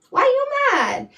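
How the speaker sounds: pitched high and quick, pressing hard; incredulous challenge. Real, not acted. Streamer to Jessica in the chat, incredulous and pressing. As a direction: demand an answer, half amused.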